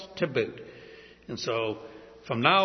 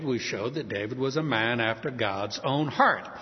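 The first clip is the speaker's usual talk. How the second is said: ordinary delivery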